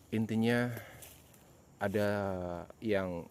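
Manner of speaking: usual speaking voice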